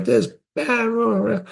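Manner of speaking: high, spluttering imitation of an angry boss; mock swearing rage. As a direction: mimic the furious young president. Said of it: "he's swearing left and right".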